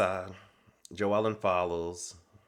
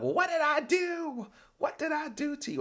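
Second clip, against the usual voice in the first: much higher whining plea; whiny high voice. Retold or imitated. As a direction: whine it up high, clueless. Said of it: "Joellen's following her like".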